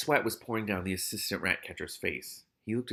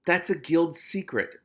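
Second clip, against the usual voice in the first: slow, higher-pitched reproach; snapped; 6 semitones higher, 4 dB louder, slower than usual. A narrator's reading. Ratcatcher One rebuking his partner over secrets, snapping. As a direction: mildly snap it, stressing each word. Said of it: "Ratcatcher One snapped at him".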